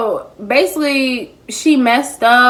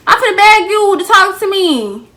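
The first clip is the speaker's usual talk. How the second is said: sarcastic mock voice, high; 8 semitones higher, 4 dB louder than usual. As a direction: mimic her sarcastically. Mocking the friend's expectation.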